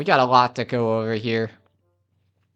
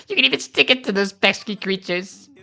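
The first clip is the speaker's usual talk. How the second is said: higher, mischievous cartoon inventor voice; pitched-up, excited character voice; gleeful inventor; 8 semitones higher than usual. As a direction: relish it like a zany inventor. Inventor presents the bomb.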